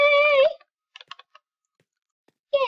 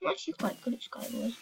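quick hushed whisper aside; 3 semitones lower, 12 dB quieter, faster than usual. Real, not acted. Gamer whispering a brag to viewers. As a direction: whisper it fast, like a secret boast.

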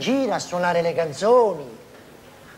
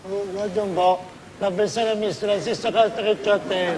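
fast parroting of the humble line; parroted modesty before the shout; mock modest repeat; faster than usual. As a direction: rattle off the lesson dutifully. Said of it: "Anche con un poco di tristezza".

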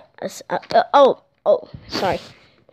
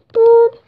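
phone boop; 9 semitones higher, 4 dB louder than usual. Pretend. Phone ring noise.

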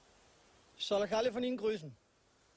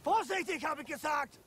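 angry shout; 7 semitones higher than usual. Acted. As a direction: bark the warning at them. Sergeant yells a warning.

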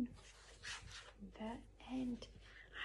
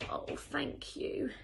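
slow, higher, grateful thanks; tired, hungry ladybird; 4 semitones higher, slower than usual. A narrator's reading. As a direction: draw out a weary thank-you. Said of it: "said the wet, tired and very hungry ladybird".

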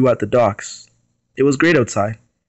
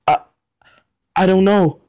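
higher stammered reply; stuttering; 7 semitones higher than usual. Acted. Dimitri answering Aries after the crash, shaken stammer. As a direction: stammer it out in shock. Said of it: "I stuttered".

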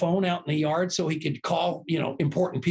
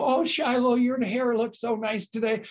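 high, sugary fake-nice compliment; butter her up in a sing-song way; playful fake praise that hides a nudge; mock sweet compliment; 7 semitones higher than usual. Mock compliment as a meeting reminder.

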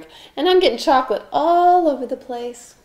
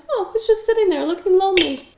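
mock sympathy; 8 semitones higher than usual. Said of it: "I'm like".

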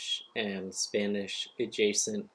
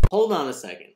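abrupt, louder and higher, cutting himself off; catching a mistake in his own cooking demo, abrupt louder call; sudden interruption; 6 semitones higher, 8 dB louder, faster than usual. Real, not acted. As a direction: stop the demo short, mid-task. Stops himself mid chopping.

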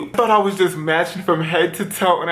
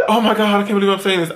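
fast, laughing confession; laughing in embarrassment; 3 semitones higher, faster than usual. Real, not acted. Confessing an embarrassing story to viewers, laughing in disbelief. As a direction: talk quickly through real giggles.

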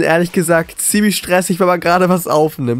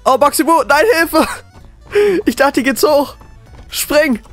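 high panicked screaming; scared horror game streamer; 11 semitones higher than usual. Real, not acted. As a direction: shriek for help in terror. Chased by the killer.

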